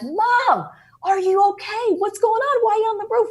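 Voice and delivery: high, loud worried call upward; alarmed child calling up to mom. Retold or imitated. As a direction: shout up to her on the roof.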